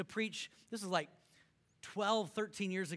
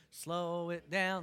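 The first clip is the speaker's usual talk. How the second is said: very slow, stretched slow it down; teaching the congregation to slow it down; slow and drawn out; much slower than usual. Shown as a demonstration. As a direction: draw every word of slow it down out. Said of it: "Say it with me".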